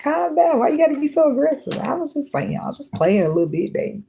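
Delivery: high, whiny, wounded voice; whiny backpedal. Pretend. Caught partner whining that she's being too aggressive. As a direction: play the hurt one backing off sweetly. Mock reply to aggressive discernment talk.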